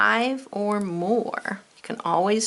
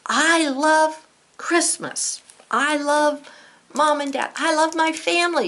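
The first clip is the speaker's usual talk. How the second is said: excited exclamation; 8 semitones higher, 4 dB louder than usual. Shown as a demonstration. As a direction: show the emotion big, like a thrilled child. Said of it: "You're showing, wow, your emotion".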